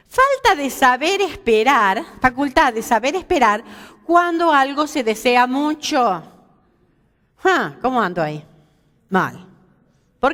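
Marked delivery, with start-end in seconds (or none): 0.1-6.2 reading the dictionary. Shown as a demonstration. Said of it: "según el diccionario".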